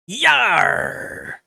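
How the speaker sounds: long, loud pirate "yar"; pirate yell. Acted. Streamer reading the pirate's description, loud pirate roar. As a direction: bellow a drawn-out pirate cry. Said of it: "I love how the pirate says R".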